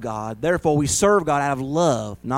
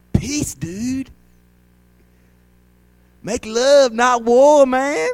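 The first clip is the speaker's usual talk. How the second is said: high, loud laid-back hippie voice; mock hippie; 9 semitones higher, 6 dB louder, much slower than usual. Pastor parodies a hippie, high and loud. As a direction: play a groovy hippie for a laugh.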